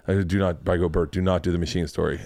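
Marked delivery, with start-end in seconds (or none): 0.2-0.7 low, softer self-warning; inner thought. Retold or imitated. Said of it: "in my head, I go". 0.9-2.2 low, quiet warning to oneself; inner thought. Retold or imitated. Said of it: "in my head, I go".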